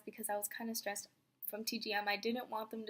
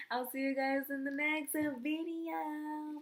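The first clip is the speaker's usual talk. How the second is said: drawn-out, rising sign-off, ending high; vlog sign-off; 4 semitones higher, much slower than usual. Real, not acted. Vlogger to viewers at video's end, drawn-out sign-off. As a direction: stretch the words out slowly, climbing to a high bye.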